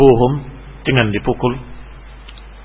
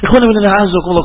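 normal talking voice

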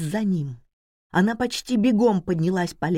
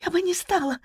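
high, tearful protest; through tears; 12 semitones higher, faster than usual. A narrator's reading. Wife denies it to her husband, speaking through tears. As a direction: protest in a much higher, tearful voice. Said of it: "сквозь слёзы проговорила она".

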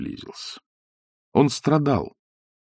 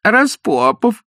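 протяжно, обиженно и выше; протянуть имя с обидой; обидчиво протянул; 11 semitones higher, 4 dB louder, much slower than usual. A narrator's reading. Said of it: "обидчиво протянул Виктор Михайлович". (обиженно протянуть)

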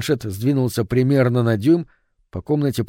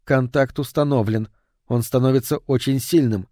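plain reading voice

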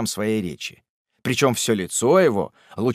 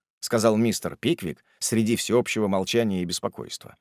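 ordinary delivery, nothing marked

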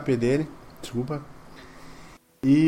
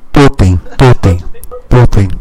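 very loud, low, heavy "totem" beats; booming thuds; 7 semitones lower, 13 dB louder, slower than usual. Acted. Game master sounding out the collapse, deep booming thuds. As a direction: boom out each word deep and hard, like pounding stone.

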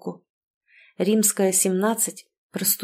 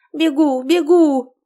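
high, loud answering call; calling back; 7 semitones higher, 7 dB louder, slower than usual. A narrator's reading. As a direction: sing out that you are on the way. Wife calls back while hurrying in.

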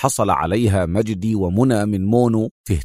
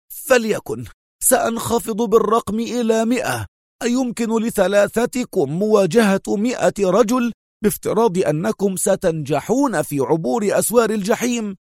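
much higher, fuming exclamation; exasperated cry; 10 semitones higher than usual. A narrator's reading. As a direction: concede the number but stay furious. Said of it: "هتف في حنق".

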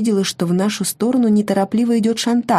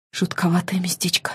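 whisper; 5 dB quieter than usual. Acted. Alone in eerie school.

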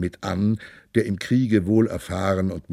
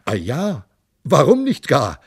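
mocking; 5 semitones higher, 4 dB louder than usual. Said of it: "höhnte Graf Raufdegen".

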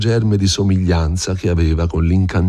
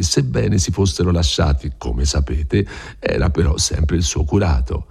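ordinary delivery, nothing marked

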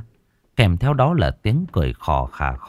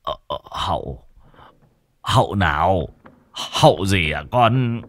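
run rẩy. Acted. Bố sợ hãi hỏi con.

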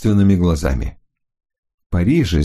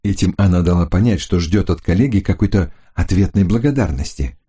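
ordinary delivery, nothing marked

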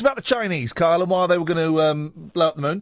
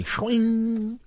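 Wayne; 4 dB quieter than usual. Retold or imitated. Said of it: "Oh, no, he said swing".

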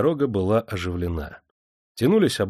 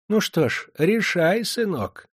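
put-on high, sweet old woman voice; sly old moneylender; 10 semitones higher, slower than usual. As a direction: coax him with honeyed old-crone talk. Moneylender's voice held.

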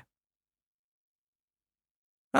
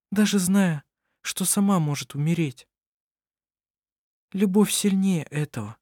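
lightly raised, gentle woman's voice; young woman voice; 7 semitones higher than usual. Acted. Anna-Maria speaks of love.

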